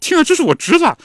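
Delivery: 又高又响，兴奋地嚷; 激动得声音都飞上去了; 兴奋极了. Retold or imitated. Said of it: "比利兴奋极了". (听到婴儿哭声，弟弟兴奋地高声嚷嚷)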